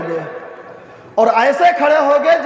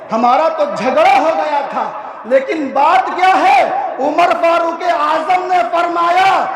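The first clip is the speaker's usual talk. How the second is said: ordinary talk, nothing marked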